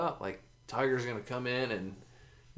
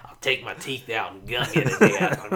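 hag waitress voice; 8 dB louder than usual. Pretend.

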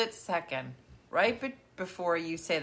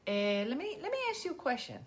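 lightly voiced grandma drawing out a question; a hint of grandma asking, higher at the end; 6 semitones higher, 5 dB quieter than usual. Granddaughter imitating her grandma's favourite line, lightly voiced. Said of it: "they always be".

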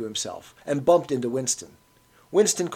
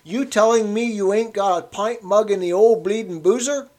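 higher, louder Cockney grumble; an old man scandalised at the bar; indignant old Cockney; 8 semitones higher, 6 dB louder than usual. A narrator's reading. Old man arguing with barman.

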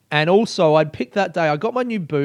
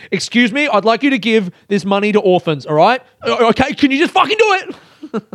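high, loud, exasperated request; mock-furious generosity boiling over; angriest charity donor; 8 semitones higher, 6 dB louder than usual. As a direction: start forcefully polite, erupt at the end. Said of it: "It's like the angriest charity".

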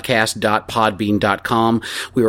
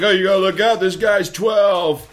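imitating Sean's warning; 5 semitones higher than usual. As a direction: drag out "This guy's twelve" as a playful warning. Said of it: "Sean's like".

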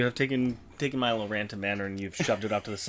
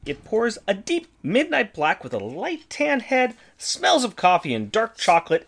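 product description read; 5 semitones higher than usual. Acted. Reads a beer description.

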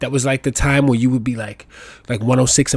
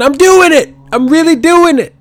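pitched way up and loud, triumphant; excited; 13 dB louder than usual. Real, not acted. Bragging about driving alone, high and loud. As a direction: celebrate like you finally made it. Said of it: "I took the training wheels off".

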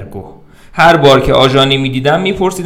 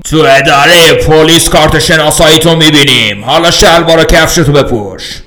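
loud, higher policeman's command; cop's order; 4 semitones higher, 8 dB louder than usual. Acted. Policeman tells the drunk to get dressed, loud and stern. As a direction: lay down the law.

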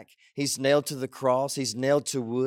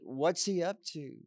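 ordinary talk, nothing marked

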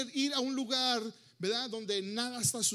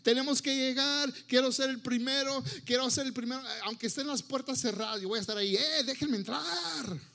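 higher, keen, rallying voice; joyful eagerness; 4 semitones higher, 3 dB louder than usual. Acted. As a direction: urge everyone along, impatient to get in. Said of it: "una actitud alegre de decir".

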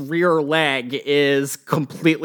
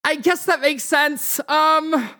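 higher-pitched, flustered homeowner; impatient woman's voice, pitched up; exasperated woman homeowner; 8 semitones higher than usual. Acted. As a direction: shoo the kids away in a raised pitch. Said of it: "she's like".

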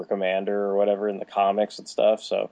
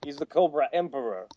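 lightly voiced exec, higher and insistent; pushy executive; 5 semitones higher, 4 dB louder than usual. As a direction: brush off an objection like a toy exec. Exec overrides the writer's objection.

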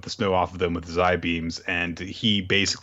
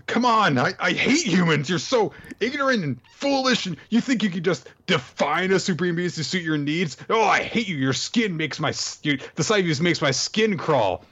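high, ranting villain meltdown; freaking out; 10 semitones higher than usual. Retold or imitated. Villain ranting at the girl, high and exasperated. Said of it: "Muskara starts freaking out".

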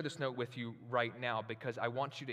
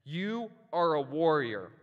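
slow, louder, stern officer's charge; stern police officer; 4 semitones higher, 8 dB louder, slower than usual. Retold or imitated. Reading the police officer's letter to the congregation, a stern charge. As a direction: hand down the words like a cop giving orders. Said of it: "that's how he talks".

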